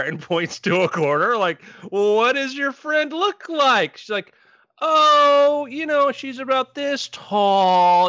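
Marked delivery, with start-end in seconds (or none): 1.9-4.0 put-on high, drawn-out question to a little kid; humoring a child. Uncle asking his niece about her invisible friend, humoring her. 4.8-8.1 put-on high, slow, drawn-out child voice; little girl. Niece telling adults about her invisible friend, sing-song child voice.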